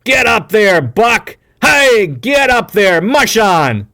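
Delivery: high, loud calls driving the team on; shouting commands at dogs. A narrator's reading. Hal yelling at Buck to get up, loud commands. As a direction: crack the order at the sled dogs. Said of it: "He uncoiled his whip".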